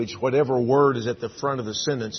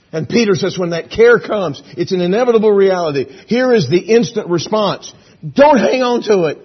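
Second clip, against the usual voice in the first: loud, raised preacher's voice, building; full-force preaching; 6 semitones higher, 9 dB louder than usual. Real, not acted. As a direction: drive the command home to the congregation.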